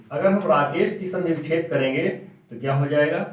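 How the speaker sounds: ordinary delivery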